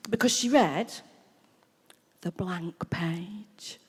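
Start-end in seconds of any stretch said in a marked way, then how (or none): 2.2-3.8 very slow, whispered reading; mock solemn reading. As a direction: read it in a drawn-out reverent whisper. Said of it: "not like a normal person reads a book".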